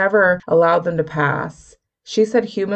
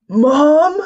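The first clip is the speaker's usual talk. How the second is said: high, loud cry of Mom; exclaimed; 10 semitones higher, 8 dB louder than usual. A narrator's reading. A son crying out to his mother's spirit, loud. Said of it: "a male voice exclaimed".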